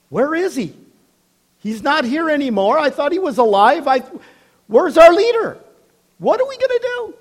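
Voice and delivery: rising into a high frantic cry; lost followers crying out in panic; panicked disciples. Retold or imitated. Disciples panicking after Jesus leaves.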